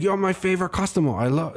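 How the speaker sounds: high, quick, beaming driver voice; imitating the driver. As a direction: rattle it off like a delighted driver. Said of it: "He's like".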